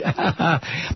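short, soft chuckle; wry laugh. Real, not acted. Commentator mocking the grab for Afghan minerals, wry laugh. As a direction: laugh briefly at your own sarcasm. Sarcastic aside on digging for minerals.

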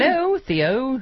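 "Hello, Theo" stretched very slow and high; sing-song "Hello, Theo", pulled out long; drawn-out high greeting. Shown as a demonstration. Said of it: "Did I do that correctly?".

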